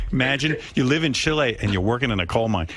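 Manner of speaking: ordinary talk, nothing marked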